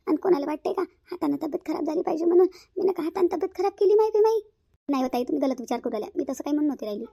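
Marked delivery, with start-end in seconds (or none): 0.1-4.4 rising, higher and louder complaint; indignant outburst. Acted. Elder sister-in-law taking offence at being doubted, indignant rise. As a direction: take offence and push it up to a high peak.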